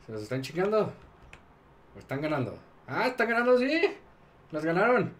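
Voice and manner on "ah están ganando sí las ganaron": higher and louder, thrilled; excited. Real, not acted. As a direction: cheer it as the win lands. Sees his troops winning.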